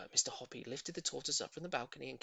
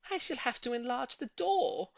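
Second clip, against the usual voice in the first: high, fussy woman; decide it with a fuss; high lady voice; 9 semitones higher than usual. A narrator's reading. Voices Missus Silver.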